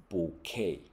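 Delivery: bouquet stressed on the final "kay", no T; silent final T. Shown as a demonstration. Teacher contrasts two words spelled alike, silent final T. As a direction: end bouquet on an open "kay". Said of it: "但发音不一样".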